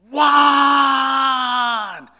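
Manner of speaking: a huge, high, loud yell of his name; full-lung shout. Retold or imitated. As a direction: bellow the name to startle him. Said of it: "as loud as he can".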